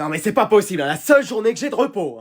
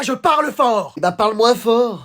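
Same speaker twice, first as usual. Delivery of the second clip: ordinary talk, nothing marked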